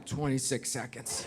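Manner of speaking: low, very quiet deadpan; acted muttered confession; sheepish admission. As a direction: drop the voice right down for the letdown. Waze admits tiny time saving.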